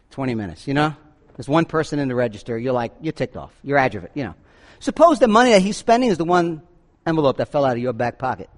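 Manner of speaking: usual speaking voice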